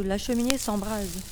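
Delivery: usual speaking voice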